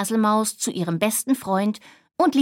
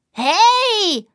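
high, loud surprised cry; sharp high Hey; startled shout; 10 semitones higher, 6 dB louder than usual. Acted. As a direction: yelp it out when hit by an acorn. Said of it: "rief der kleine Siebenschläfer".

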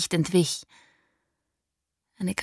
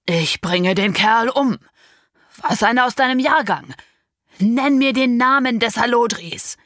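raging threat; 5 semitones higher, 5 dB louder than usual. Acted. Father threatening the unknown lover, in a rage. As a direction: spit out the threat.

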